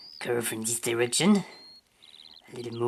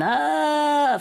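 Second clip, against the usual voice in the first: long high, loud "ah"; sustained high wail, put on; playful spirit cry; 13 semitones higher, 5 dB louder than usual. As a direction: cry out like a little flaming spirit. Voices the open-mouthed flaming spirit.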